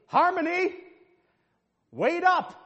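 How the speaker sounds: put-on high, loud calling out to a child; mother's yell. Mother calling her lagging daughter, loud high yell. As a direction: holler across the mall after the girl. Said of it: "the mother yells".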